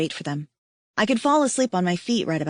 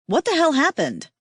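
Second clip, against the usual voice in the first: alarmed; 7 semitones higher than usual. Acted. Liz alarmed at the scene.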